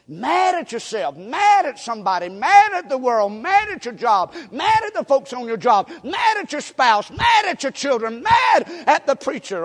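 preacher working up the crowd; building sermon shout. Real, not acted. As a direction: pile it on, line by line, to a shout.

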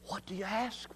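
unvoiced hushed question; whisper. Real, not acted. Preacher whispering the closing question to the congregation. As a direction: hush it to a whisper.

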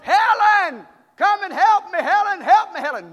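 loud, high yell for Helen; hollering for help. Retold or imitated. Danny hollering to his wife for help, mock yell. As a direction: cry out for help from under the mower. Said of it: "he hollered to his wife".

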